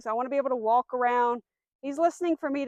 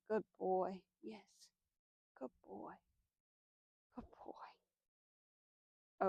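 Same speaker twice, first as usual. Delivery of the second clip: soft praise; 3 semitones lower, 13 dB quieter, much slower than usual. Real, not acted. Trainer softly praising her dog, hushed. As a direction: croon praise to a pleased dog.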